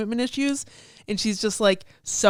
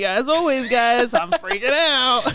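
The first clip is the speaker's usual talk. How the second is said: loud, high, drawn-out entrance line; playful proclamation, last words stretched; mock announcement; 4 semitones higher, 6 dB louder, slower than usual. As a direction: announce it to the whole room, hammed up. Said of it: "me walking into the hangout".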